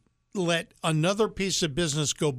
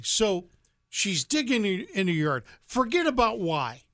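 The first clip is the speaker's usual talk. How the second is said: usual speaking voice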